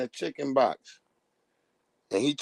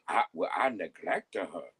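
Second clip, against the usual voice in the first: mock Judge Mathis; 9 semitones lower, 4 dB quieter than usual. Voicing Judge Mathis excuse.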